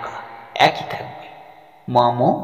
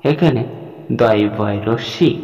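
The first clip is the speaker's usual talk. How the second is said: ordinary talk, nothing marked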